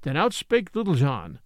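ordinary delivery, nothing marked

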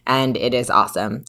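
ordinary talk

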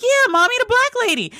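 bursting to convince her; excited insisting. Retold or imitated. Daughter convincing mom about the show, high and eager.

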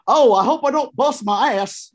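mock yell on the slide; retold yell. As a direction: call it out like she did. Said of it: "And then she just yells".

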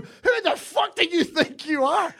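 high, slow, indignant challenge; acted confrontation. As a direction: re-enact having words in the car park. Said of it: "had words with him".

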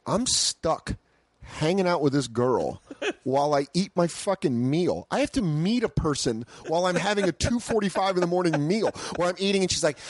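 ordinary delivery

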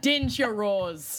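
put-on slow drawn Minnesotan accent. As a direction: lean into the Minnesotan vowels slowly. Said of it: "their worst Minnesotan accents".

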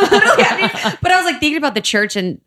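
laughing. Real, not acted. Laughing after the joke.

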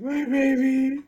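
very high, slow cooing; high baby talk. Retold or imitated. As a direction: coo it high and drawn out. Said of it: "I was like".

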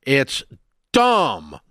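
DUMB stretched into two syllables, intense; drawn-out dumb. Real, not acted. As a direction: drag out dumb with emphasis. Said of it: "you added an extra syllable to that dumb. It was that intense".

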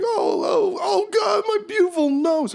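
high-pitched hammed-up wailing; mock sobbing, far above normal pitch; weeping and blubbering. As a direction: wail over a broken nose in a very high voice. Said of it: "he starts weeping and blubbering".

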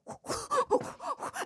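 high, airy whooshing noises; imitated nunchuck noises. Comic showing how he swung nunchucks, in high imitated whooshes. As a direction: mimic swinging nunchucks with quick high whoops. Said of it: "he's going".

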